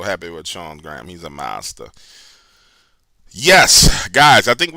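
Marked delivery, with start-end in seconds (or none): 3.3-4.7 loud. Real, not acted. Sudden loud announcement.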